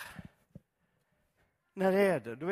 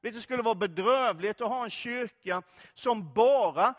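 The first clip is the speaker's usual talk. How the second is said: usual speaking voice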